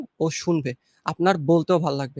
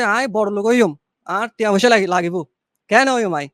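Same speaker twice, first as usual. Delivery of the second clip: louder Chittagonian accent; Chittagong dialect; 5 dB louder than usual. Shown as a demonstration. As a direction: switch to a loud Chittagong dialect. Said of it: "চট্টগ্রামের ভাষাকে যদি বলি". (চট্টগ্রামের আঞ্চলিক টানে, জোরে)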